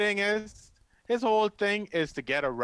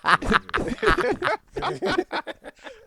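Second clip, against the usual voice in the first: loud high-pitched cackle of real laughter; high burst of laughter; 12 semitones higher, 3 dB louder than usual. Real, not acted. Friend watching the clip bursts into a high real laugh. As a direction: break into a big high laugh.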